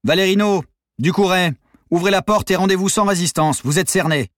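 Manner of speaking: loud, high police command; megaphone shout. A narrator's reading. Police ordering trapped gang out, megaphone shout. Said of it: "La voix dans le mégaphone".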